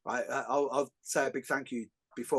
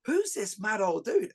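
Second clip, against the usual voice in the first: mock baffled students; 6 semitones higher, slower than usual. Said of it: "they're like".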